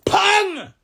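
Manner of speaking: a sharp, loud, high "pong" for the catapult stone. Retold or imitated. Showing his friend how the catapult fires, a loud high pong. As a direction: snap out the slingshot sound effect.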